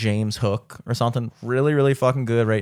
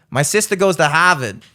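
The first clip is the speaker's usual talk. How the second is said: Boston accent; 5 semitones higher, 4 dB louder than usual. Shown as a demonstration. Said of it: "I can do that".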